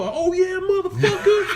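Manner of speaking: very high-pitched caricature; mock the driver in a squealed voice; mocked wannabe driver. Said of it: "he's a wigger. He's a total wigger".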